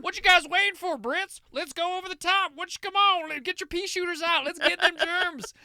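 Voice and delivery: high, loud gung-ho yell; rowdy cowboy soldier. Retold or imitated. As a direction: whoop it up like a cowboy charging. Voicing American cowboy soldiers.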